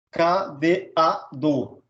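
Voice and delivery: "Cadeado" very slow, as ca, de, a, do; syllable by syllable. Shown as a demonstration. Teacher showing a hiatus, "Cadeado" slowly syllable by syllable. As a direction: separate every syllable of "Cadeado". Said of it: "devem ser separados em duas sílabas".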